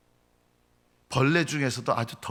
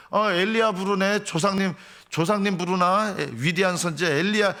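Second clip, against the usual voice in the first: high, raised-voice jeering; mocking; 7 semitones higher, 5 dB louder than usual. Crowd mishears Jesus calling Elijah, mocking. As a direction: make fun of a misheard cry. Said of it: "하면서 예수님을 조롱했어요".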